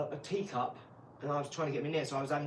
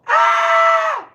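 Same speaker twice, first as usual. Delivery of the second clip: piercing mock scream, much higher and louder; scream from upstairs; 20 dB louder than usual. Wife screaming upstairs, imitated piercing scream. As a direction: let out a sudden shriek of alarm. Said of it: "I heard".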